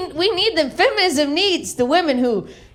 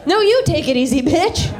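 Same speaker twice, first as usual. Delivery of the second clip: higher, pointed comeback; sassy retort; 4 semitones higher than usual. Acted. Kid talks back to drunk mom, sassy. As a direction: throw the words back at her.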